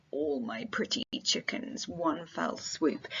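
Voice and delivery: whispered, slowing on the last words; hushed whisper. Acted. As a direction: breathe the line as a stricken aside. Teacher quoting Macduff's grief.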